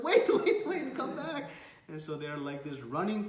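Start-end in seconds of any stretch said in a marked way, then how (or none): 0.0-1.5 high, loud mock-frantic call; calling after someone. Nityananda calls after fleeing Chaitanya, frantic. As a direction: call out as if chasing a runaway.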